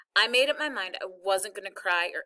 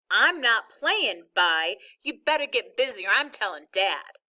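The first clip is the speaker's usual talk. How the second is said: irritated warning; 5 dB louder than usual. Acted. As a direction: tell him off, fed up with his trick. Kid angrily refusing the trick.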